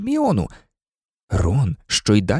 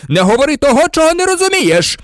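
loud, very high-pitched scream of rage; frenzied yelling; crazed shout; 14 semitones higher, 6 dB louder, faster than usual. A narrator's reading. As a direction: scream it as if out of your mind. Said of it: "закричав раптом Снейп, мов божевільний".

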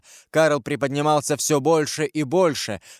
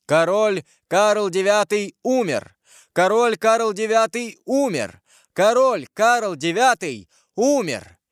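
high, slow herald's cry; shouted proclamation; 8 semitones higher, 3 dB louder than usual. A narrator's reading. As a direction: proclaim a death to the whole courtyard. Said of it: "три раза крикнул". (громко, протяжно возглашать)

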